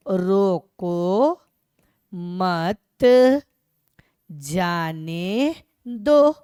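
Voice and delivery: very slow, word by word with long gaps; slow dictation. Shown as a demonstration. Teacher writing an example sentence for students, slow dictation. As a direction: stretch each word as if writing it out. हम एक वाक्य लिख रहे हैं.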